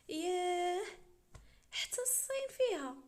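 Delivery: high, loud put-on astonishment; mock amazement. Visitor teasing the sheikh about his imported decor, mock amazement. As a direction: leap up high in exaggerated wonder.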